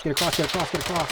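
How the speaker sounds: high, loud urging; urgent shout. Real, not acted. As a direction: yell at him to move across. Urging teammate across a gap.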